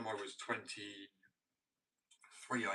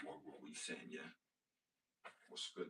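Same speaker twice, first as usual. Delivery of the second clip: lower, softer street-talk act; casual with mates; 4 semitones lower, 7 dB quieter than usual. Acted. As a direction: play it casual, like greeting your mates. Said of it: "a little bit more casual".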